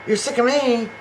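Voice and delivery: imitating Javi. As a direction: play the hurt boyfriend, hammed-up. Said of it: "There he is with this little cute face".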